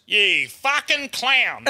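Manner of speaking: slow mock yell at me; drawn-out insult from his car; bloke yelling. Driver yelling at me from his car, slow mocking yell. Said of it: "and he goes".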